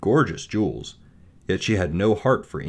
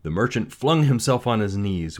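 plain reading voice

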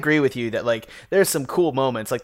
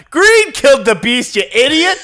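laughing mock yell; 11 semitones higher, 6 dB louder than usual. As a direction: shout it like dad in the theater, half laughing. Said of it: "my dad just in the theater yelled out".

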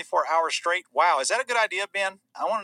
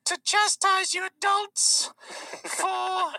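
squeaky, drawn-out mock scolding; Greta impression; much slower than usual. Activist chastising adults, wailing slow high-pitched parody. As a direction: stretch the words very slowly, very high.

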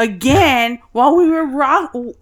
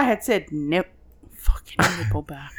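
whisper; 10 semitones lower, 6 dB quieter than usual. Real, not acted. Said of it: "whispered aside".

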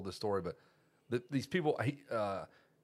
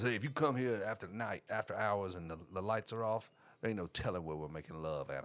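imitated drunk dad; 3 semitones lower, 5 dB quieter than usual. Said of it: "he's had forty-seven beers".

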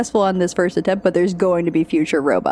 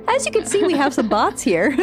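Disney tour guide; 6 semitones higher than usual. Retold or imitated. Teasing echo of the pitch.

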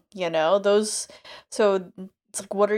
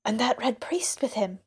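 hushed gossip; 3 semitones higher than usual. A narrator's reading. Villagers gossiping, whispered aside.

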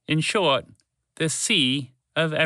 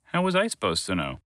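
grumpy; 6 semitones lower than usual. Acted. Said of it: "Walter replied grumpily".

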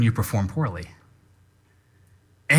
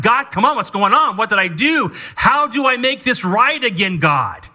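exasperated plea to God; 7 semitones higher than usual. Retold or imitated. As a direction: throw up your hands and complain to God. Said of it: "and I kinda want".

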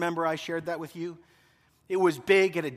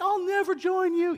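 put-on much higher, young defiant voice; high whiny Luke; 13 semitones higher than usual. Luke defying Darth Vader, high whiny voice. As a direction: squeak it like a teen hero. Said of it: "he always had that really high".

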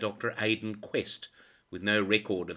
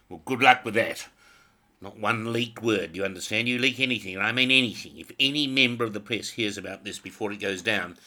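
louder, hammering warning to a subordinate; angry threat; 2 semitones higher, 7 dB louder than usual. Acted. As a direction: threaten him, jab every line.

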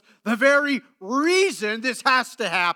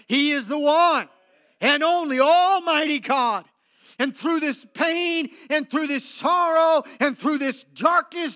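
rising, louder preacher's build; fervent preaching; 4 semitones higher, 3 dB louder than usual. Acted. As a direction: preach it out with full conviction. Preaching at full force.

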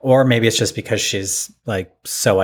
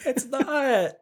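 laughing; 5 dB quieter than usual. Real, not acted. Laughing agreement.

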